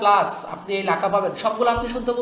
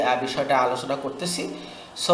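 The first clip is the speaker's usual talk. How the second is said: ordinary delivery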